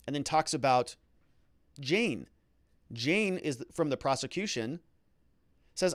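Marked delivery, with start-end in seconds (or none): none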